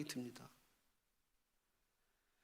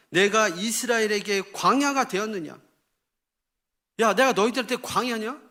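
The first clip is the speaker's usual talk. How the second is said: loud, raised challenge; indignant rebuke; 5 semitones higher, 7 dB louder than usual. Acted. God asks Israel if he was a wilderness, rebuking. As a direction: demand it loudly, as God offended. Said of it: "책망하시는 말씀이에요".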